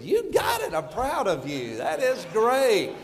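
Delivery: delighted praise. Retold or imitated. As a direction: cheer her on with delight. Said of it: "I said".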